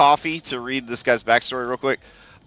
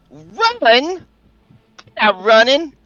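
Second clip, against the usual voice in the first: high, loud shouted comeback; defiant yell; 13 semitones higher, 6 dB louder, much slower than usual. Acted. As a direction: holler back, pitched way up. Enemy answers with a yell.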